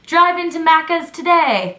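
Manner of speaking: bright ad-read pitch; light imitation of an Australian ad; mock ad voice. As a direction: sell it like a commercial. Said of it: "their commercials say".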